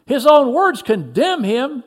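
high, loud, drawn-out accusation; shout. A narrator's reading. Another in the crowd condemns him, shouting slowly. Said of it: "shouted another".

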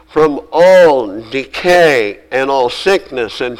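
each all slow and stressed; emphasis on all. Real, not acted. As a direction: hammer every all, slowly. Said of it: "I emphasize the word all".

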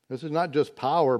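ordinary delivery

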